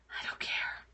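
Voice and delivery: unvoiced, breathy whisper of spite. Real, not acted. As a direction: breathe it out, voiceless. Repeats line, second one whispered.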